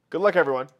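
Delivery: normal talking voice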